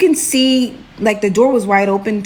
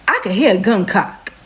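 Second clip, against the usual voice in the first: ordinary delivery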